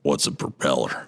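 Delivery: low Western gunslinger voice; Arthur Morgan. Acted. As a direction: play a baffled Old West outlaw. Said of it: "I'm gonna do my Arthur Morgan voice again".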